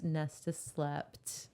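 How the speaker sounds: tender bedtime close; soft slow storybook ending. A narrator's reading. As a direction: let it settle gently. Ending a romantic retelling.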